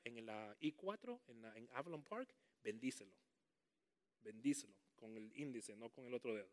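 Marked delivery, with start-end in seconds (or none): none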